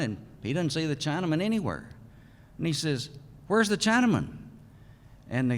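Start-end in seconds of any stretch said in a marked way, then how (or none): 3.5-4.3 raised, carrying call across the site. Retold or imitated. Boss calls across the site for his missing worker, raised voice.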